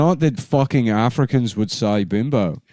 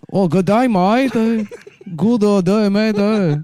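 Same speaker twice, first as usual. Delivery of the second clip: hammed-up mock accent, pitched way up; Australian African accent; 9 semitones higher than usual. A friend, clowning on request, doing his mock accent. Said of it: "Australian African".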